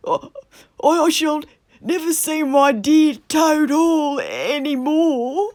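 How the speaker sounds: high, crying wail; sobbing Toad. A narrator's reading. Toad weeping over his dear Toad Hall, sobbing. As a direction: blubber it out like a child. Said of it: "sobbed Toad".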